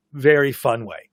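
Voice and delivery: ordinary delivery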